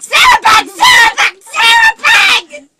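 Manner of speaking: very high-pitched shrieking; imitated kids' piercing squeal, repeated; excited little kids. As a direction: scream the nickname like a small child. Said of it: "your niece and nephew".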